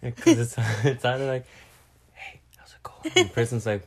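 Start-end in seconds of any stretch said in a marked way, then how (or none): 2.1-3.1 breathy unvoiced whisper; barely audible whisper. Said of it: "only be able to whisper".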